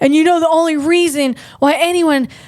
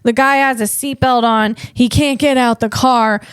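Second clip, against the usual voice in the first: usual speaking voice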